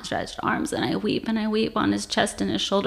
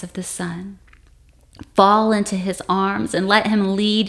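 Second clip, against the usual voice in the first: earnest, measured exhortation with weighty pauses; solemn preaching; 3 dB louder than usual. Acted. Speaker calls the church home, solemn preaching appeal. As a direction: appeal to the church, drawing out the phrase ends.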